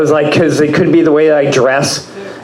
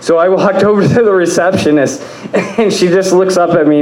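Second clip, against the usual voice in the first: ordinary talk, nothing marked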